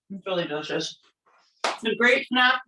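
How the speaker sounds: talking through a mouthful of food; mouth full. Real, not acted. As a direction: speak around a bite of food. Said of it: "talking with my mouth full".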